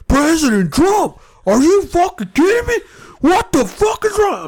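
high, loud shrieking outrage, mimicked; mock screaming girl. Retelling a protester screaming in a reporter's face, shrill mock screaming.

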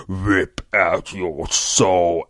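slow, loud demon croak; frog monster voice. Retold or imitated.

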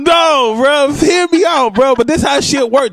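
high, insistent pleading; tipsy bar argument. Real, not acted. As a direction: hear me out, pushing hard. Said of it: "What was you drinking last night?".

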